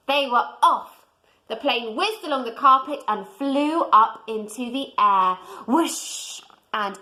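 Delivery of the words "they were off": usual speaking voice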